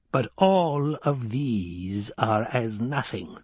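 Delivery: a lightly voiced stern old woman, slowing at the end; measured old crone's warning to the boy; old Reverend Mother. Reverend Mother warns Paul, lightly voiced stern old woman.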